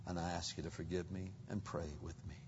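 low, soft husband's confession; humble apology. Acted. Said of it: "that reluctance to say".

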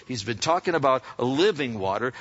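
normal talking voice

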